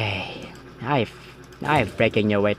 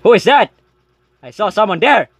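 startled; 8 semitones higher, 6 dB louder than usual. Real, not acted. Startled by a figure.

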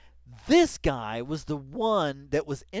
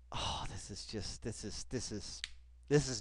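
hushed awe; 5 semitones lower, 10 dB quieter than usual. Real, not acted. Stunned hush.

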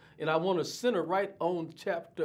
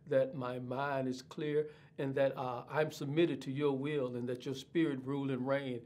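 hushed, softer prayer voice; opening prayer; 5 dB quieter than usual. Acted. Pastor praying to God before the lesson, softer and hushed.

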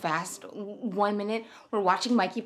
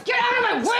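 furious shout, much higher; angry shout; 6 dB louder, faster than usual. Acted. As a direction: yell it to force your way through. Forcing past to help neighbor.

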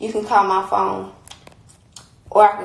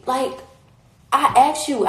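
ordinary delivery

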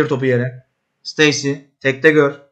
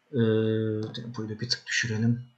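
much softer, low muttered instruction; quiet aside; 6 semitones lower, 13 dB quieter than usual. Real, not acted. Manager quietly telling the team to lower the tempo, soft low aside. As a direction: drop right down, half to yourself.

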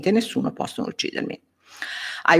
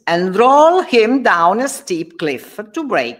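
higher, louder slow order; cruel command; 7 semitones higher, 5 dB louder than usual. Acted. Faustus sentencing Martino, cruel command. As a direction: order it with relish.

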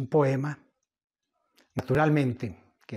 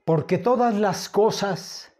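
louder, higher declaimed verse with long pauses; poem recitation; 4 semitones higher, 4 dB louder than usual. Acted. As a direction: declaim the poem grandly, phrase by phrase.

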